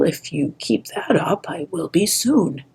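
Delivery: soft, whispered aside; whispered thought. Acted. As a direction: mutter it under your breath. Clay's private sarcastic thought.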